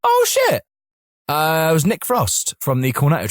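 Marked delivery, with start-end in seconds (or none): 0.0-0.6 high-pitched acted gasp of recognition; startled, high exclamation; shocked realisation. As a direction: act out suddenly realising who it is. Sudden realisation of who it was.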